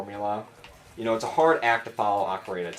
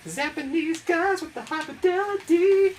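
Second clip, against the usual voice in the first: squeaky high voice. Acted. Silly high voice while playing.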